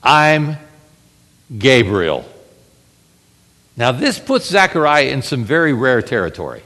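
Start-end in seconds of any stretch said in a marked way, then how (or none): 0.0-2.3 lightly stern Gabriel; stern angel. Acted. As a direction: drop low on the name, slow and firm.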